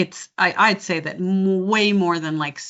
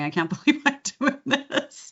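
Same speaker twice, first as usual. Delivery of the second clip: put-on higher-pitched Australian accent with a laugh; 7 semitones higher than usual. Coach marvelling at being on set, Australian accent. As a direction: giggle through it in an Australian accent. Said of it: "in an Australian accent going".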